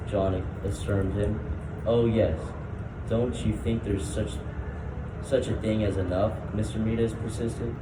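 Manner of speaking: usual speaking voice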